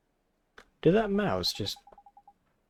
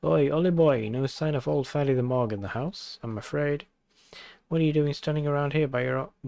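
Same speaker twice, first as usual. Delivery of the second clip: Welsh character voice. Acted. Drippy calling to Oliver, hearty Welsh voice. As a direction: call out in a Welsh lilt like an old friend.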